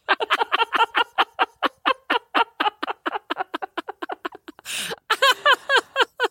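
high, breathless real cackling; long helpless laughter. Real, not acted. Storyteller cracking up at snow jump, helpless laughter. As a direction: laugh on and on until spent.

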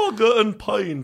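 high, drawn-out British pub call; mock British accent. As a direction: ham up a British lad, stretching the words. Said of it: "drar på sånn britisk aksent".

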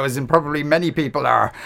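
ordinary delivery

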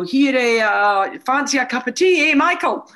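mock the chummy regional Fife accent offering tea. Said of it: "with a regional accent".

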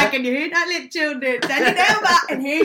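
high, laughing through the words; giggling while teasing her ma. Real, not acted. Teasing her ma in the waiting room, laughing.

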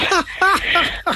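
bright burst of laughter at her own joke; short amused laugh. Real, not acted.

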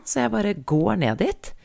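normal talking voice

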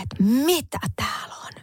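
whispered question, voice gone from it; acted whisper. Quoting a partner who found the smelly dog box, whispered. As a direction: imitate someone whispering in dismay. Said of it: "Sit se on silleen".

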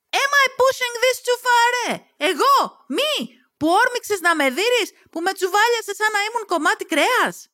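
high-pitched, incredulous outburst; outraged. Acted. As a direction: explode in disbelief, pitch shooting up. Heroine explodes at Luca's accusation. (έξαλλη, με ψηλή τσιριχτή αγανάκτηση)

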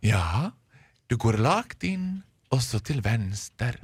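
slow, drawn-out storybook elder; fairy-tale old woman. Retold or imitated. Older shop woman from a fairy tale answering a customer, slow storybook voice. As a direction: answer very slowly, with long pauses. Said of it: "en äldre kvinna".

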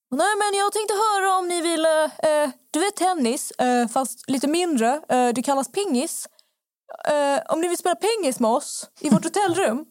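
high-pitched put-on Swedish dialect; imitated regional dialect. Man inviting women to hotel-room ping-pong, mimicked dialect. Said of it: "han pratar med någon dialekt".

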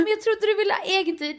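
high, mildly flustered protest; retold panic. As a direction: imitate a bewildered, panicking partner. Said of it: "han får panik".

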